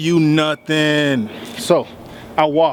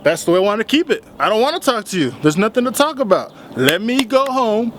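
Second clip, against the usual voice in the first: higher-pitched retort, dragging out the last plea; refusing to talk; 6 semitones higher than usual. Retold or imitated. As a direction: shut him down, stretching the final words.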